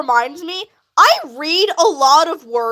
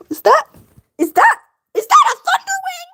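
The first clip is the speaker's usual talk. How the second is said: excited gasp; 13 semitones higher than usual. Real, not acted. Gasps at a character.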